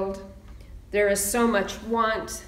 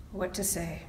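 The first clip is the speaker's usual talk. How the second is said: much softer, to himself; quiet repeat; 2 semitones lower, 9 dB quieter than usual. Real, not acted. Minister musing to himself about the sermon, much softer. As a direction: drop the repeat almost under the breath. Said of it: "repeated musing question".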